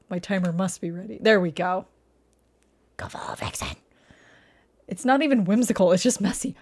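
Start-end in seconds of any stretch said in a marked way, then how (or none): 3.0-3.8 much lower voiceless whisper; whispered aside. Real, not acted. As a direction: murmur it under your breath.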